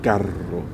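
CARRO with a strongly trilled double R; rolled double R. Shown as a demonstration. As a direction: roll the R in "Carro". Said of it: "practicing the double R sound".

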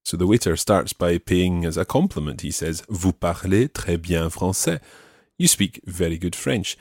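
ordinary delivery

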